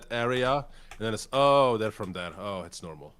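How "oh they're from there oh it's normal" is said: dawning realisation. Retold or imitated. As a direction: act out people suddenly understanding, then easing off. Voices people's relieved realisation.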